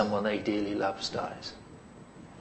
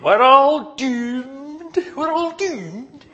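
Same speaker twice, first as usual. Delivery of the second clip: high, drawn-out Scottish wail; hammed-up Scottish accent, slow and doom-laden; Fraser from Dad's Army; 12 semitones higher, 6 dB louder, much slower than usual. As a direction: wail it like a doom-struck Scotsman. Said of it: "in a wonderful Scottish accent".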